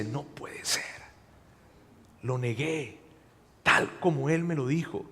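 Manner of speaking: almost no voice, crushed; whispered dismay after the rooster; stunned whisper. Retold or imitated. Peter realizes after rooster crows.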